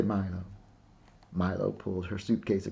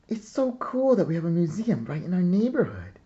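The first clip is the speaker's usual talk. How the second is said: put-on higher and louder, an eager kid's exclamation; excited exclaim; 9 semitones higher, 5 dB louder than usual. A narrator's reading. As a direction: burst out with delight at the museum. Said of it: "Mallory exclaimed".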